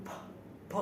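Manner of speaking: ফ given alone, with a puff of air; aspirated ফ sound. Shown as a demonstration. Teacher giving the Bengali sound for P, isolated aspirated ফ. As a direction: sound out ফ separately, twice. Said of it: "ফ উচ্চারিত হয়".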